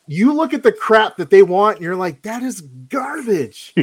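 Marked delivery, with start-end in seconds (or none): 2.2-3.7 higher-pitched, drawn-out dismissal; mock disgust. Parent reacting to the junk kids want, mock disgust. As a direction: pronounce the verdict slowly in exasperated disbelief.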